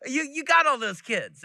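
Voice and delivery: imitating a Texan lady pressing leftovers; another leftovers lady. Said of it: "You get, like".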